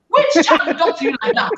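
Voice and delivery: normal talking voice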